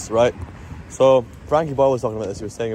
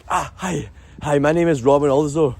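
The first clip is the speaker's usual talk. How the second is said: higher, jumpy nervous introduction; 4 semitones higher than usual. Shown as a demonstration. Nervously introducing himself to someone, nervous. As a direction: pull back as if retreating. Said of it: "then that's nervous".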